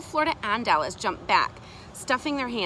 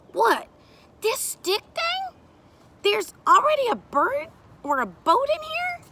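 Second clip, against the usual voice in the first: very high, loud disbelief; incredulous child; 7 dB louder than usual. Acted. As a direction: question it like an astonished kid. Child doubts the stick.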